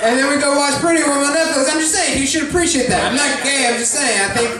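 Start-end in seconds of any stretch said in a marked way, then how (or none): none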